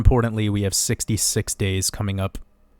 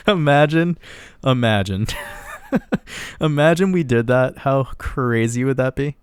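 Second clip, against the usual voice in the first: laughing between excited words; amused excitement; 4 semitones higher than usual. Real, not acted. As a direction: break into giggles at a wild idea. Giggling over imagined price jump.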